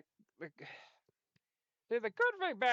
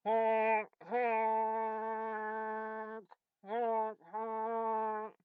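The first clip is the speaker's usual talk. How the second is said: silly clown honking; 4 semitones higher, much slower than usual. Acted. Imitating train horn.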